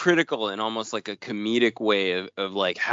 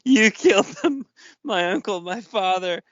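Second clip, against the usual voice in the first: higher-pitched dramatic comic-book reading; mock melodrama; 8 semitones higher than usual. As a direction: read it out as hammed-up, anguished dialogue. Reads comic dialogue aloud in mock drama.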